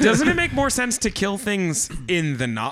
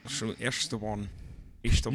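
Sean Connery impression; 9 semitones lower, 8 dB quieter than usual. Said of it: "You just sound like Sean Connery".

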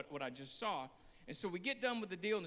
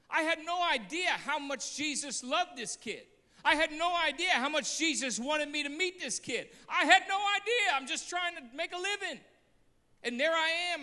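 higher, louder preacher's cry; preaching at full force; 9 semitones higher, 7 dB louder than usual. Acted. Preacher cries out to the church how Jesus loved the boy. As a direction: break open as you preach the love.

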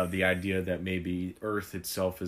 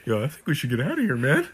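panicked; 5 semitones higher, 6 dB louder, faster than usual. Acted. Panicked at Saiyans arriving.

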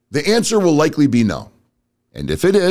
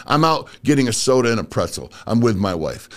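normal talking voice

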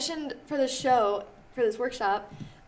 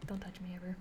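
softer, breathy mock threat; whispered warning; 5 semitones lower, 14 dB quieter than usual. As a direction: whisper it like a creepy sign-off. Jokey closing line to her story.

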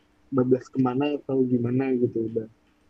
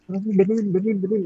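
retold urgent plea; 7 semitones higher than usual.

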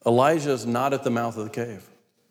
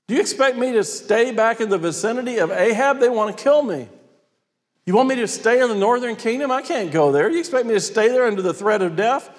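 high, exasperated protest; Elijah; 9 semitones higher than usual. Acted. Hunted prophet challenging God, exasperated. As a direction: argue back in disbelief, pitch way up. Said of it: "Elijah says".

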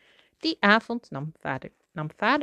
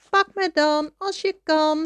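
high, loud playful taunt; teasing laughter; 11 semitones higher, 5 dB louder than usual. A narrator's reading. Shrew mouse teasing Toby with the stolen hoop, high taunt. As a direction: dare them to chase you in a raised singsong. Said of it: "lachte hij".

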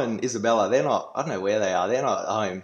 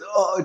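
pained groan; 9 semitones higher than usual. Retold or imitated. Injured brother groaning.

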